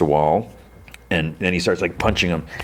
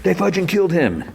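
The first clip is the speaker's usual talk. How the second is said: mock outraged cry, much higher; hammed-up outrage without full volume; outraged outburst; 13 semitones higher than usual. Retelling a character's interruption, outraged high-pitched outburst.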